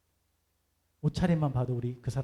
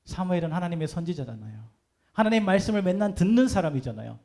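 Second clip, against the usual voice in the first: ordinary talk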